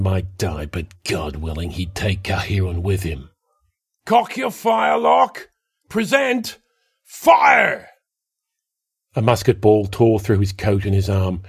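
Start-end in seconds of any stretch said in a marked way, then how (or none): 4.0-7.9 high, loud drill commands; shouted commands. A narrator's reading. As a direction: call the volley, rising to fire. Officer commanding a volley.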